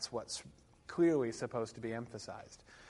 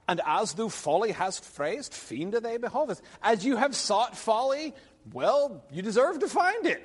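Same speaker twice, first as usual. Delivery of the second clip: high, loud declaimed verse; Middle English verse read aloud; 10 semitones higher, 8 dB louder than usual. Acted. Lecturer reading Arthur's response to the class, declaimed Middle English. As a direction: declaim old verse with big pitch swings.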